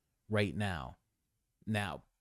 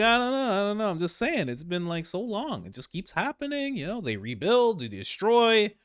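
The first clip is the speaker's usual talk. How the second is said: higher, breezy feigned innocence; mock Trump; 9 semitones higher than usual. Trump dodging Bibi's question, higher feigned innocence. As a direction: play dumb, brushing it off lightly. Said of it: "Trump's like".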